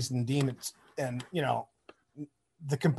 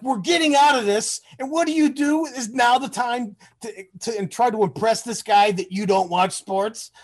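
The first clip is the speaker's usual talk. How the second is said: higher, louder retold exasperation; exasperated rant; 7 semitones higher, 4 dB louder than usual. Friend Brad chewing him out for blowing it, in mock exasperation. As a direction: replay the friend's disbelief, pitched up.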